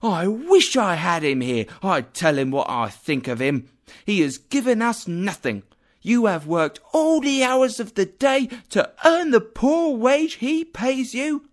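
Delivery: wife raging at Scrooge for her husband, high and loud; indignant wife. Acted. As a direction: let the resentment boil over. Angry wife scolding absent boss.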